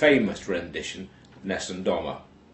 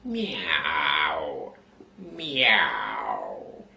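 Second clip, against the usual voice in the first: cat meow; 7 semitones lower, 4 dB quieter than usual. Acted. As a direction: yowl it like a big tomcat. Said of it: "and then goes".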